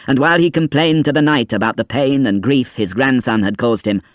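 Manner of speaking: usual speaking voice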